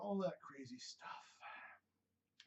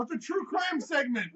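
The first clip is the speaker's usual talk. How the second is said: usual speaking voice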